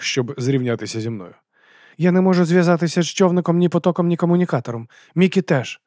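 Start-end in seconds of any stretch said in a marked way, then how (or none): 2.0-5.8 high, urgent female character; worried woman reporting. A narrator's reading. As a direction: report the problem in a raised voice. Abena reports lost contact.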